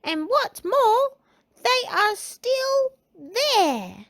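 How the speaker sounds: put-on very high, slow baby-bear voice; crying out as Baby Bear. A narrator's reading. Baby Bear spotting the intruder in his bed, high-pitched cry. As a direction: draw out each word, high and piping. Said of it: "he cried".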